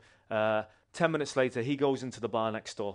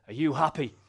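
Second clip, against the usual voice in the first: louder, higher imitated demand; retold blunt challenge; 4 semitones higher, 7 dB louder than usual. Bar owner staring down his guest, retold challenge. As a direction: put the question like a confrontation.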